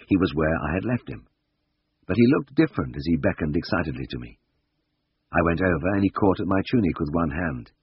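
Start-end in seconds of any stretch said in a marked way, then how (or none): none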